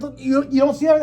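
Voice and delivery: high, loud, insistent plea; frantic disbelief. Retold or imitated. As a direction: press your friend to look, pitch jumping up. Urges friend to see the bottle.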